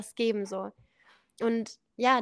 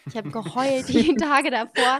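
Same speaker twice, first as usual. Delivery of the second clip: laughing; 2 semitones higher, 9 dB louder than usual. Real, not acted. Telling the host she cried before the trip, laughing. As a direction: laugh through the confession, louder.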